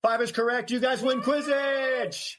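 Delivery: excited announcer. Pretend. Winner announcement.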